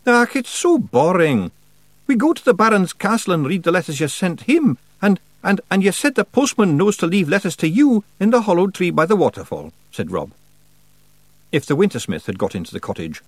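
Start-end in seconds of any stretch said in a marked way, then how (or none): 0.1-9.7 high, loud Scots character voice; reluctant Feegle confessing. A narrator's reading. Rob's character voice in dialogue.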